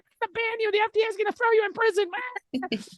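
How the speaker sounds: very high squeaky mock voice; mocking censor voice. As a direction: do the censors as a piercing, silly squeal.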